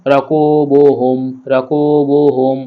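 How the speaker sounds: measured recitation with "কু" and "বু" held; model for repetition. Shown as a demonstration. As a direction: lead learners through "র কু বু হুম", stretching each u. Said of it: "আপনারা আমার সাথে সাথে পড়ুন". (শেখানোর সুরে "কু বু" টেনে)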